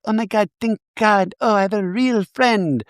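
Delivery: high, breathy-free squeaky mock Jennifer; high mock Jennifer Tilly gushing over a tarot card. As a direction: gush like a ditzy star.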